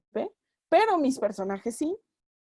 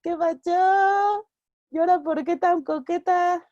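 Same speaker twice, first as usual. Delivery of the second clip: slow, higher and louder street catcall; rough flirting mechanic; 7 semitones higher, 5 dB louder than usual. Pretend. Mechanic flirting with a client, slow loud catcall. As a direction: drag it out like a cheeky mechanic chatting up a customer. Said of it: "Cuando el mecánico es".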